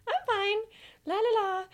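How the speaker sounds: breezy la-la-la dismissal; carefree. Retold or imitated. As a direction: toss it off like nothing can touch you. Brushing off the warning carelessly.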